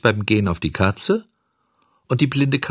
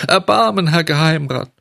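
high, loud wail for mercy; tearful pleading; 8 semitones higher, 5 dB louder than usual. A narrator's reading. Puppet raising the flattery for the director, pleading. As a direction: beg in a rising wail. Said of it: "weinte und flehte".

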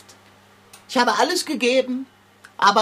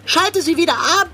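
urgent command; 8 semitones higher, 4 dB louder than usual. Acted. Cat urgently demands machine off.

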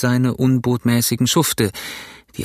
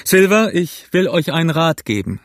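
higher-pitched hail into advice; raised, emphatic address; calling out; 6 semitones higher, 3 dB louder than usual. A narrator's reading. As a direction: call over to him with a warning. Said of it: "rief er".

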